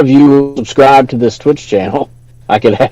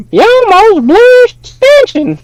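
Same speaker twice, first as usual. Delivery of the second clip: squeaky falsetto onlooker; imitated high-voiced guy. Streamer spoofing the onlooker after the jump, falsetto mimicry. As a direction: call it out in a very high mock voice. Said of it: "that don't have the deep voice".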